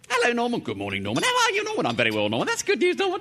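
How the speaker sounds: two-voice self dialogue. Acted. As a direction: play both sides of a chat. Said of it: "I'll talk to myself then".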